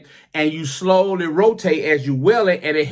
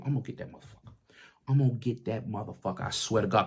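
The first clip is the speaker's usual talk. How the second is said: soft muttered vow, lower; muttered threat; 5 semitones lower, 9 dB quieter, faster than usual. Retold or imitated. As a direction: grumble the threat under your breath. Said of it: "I'm telling people".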